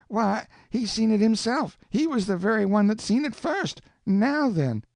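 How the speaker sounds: put-on high-pitched, protesting young voice; a boy insisting he's right; indignant boy Huck.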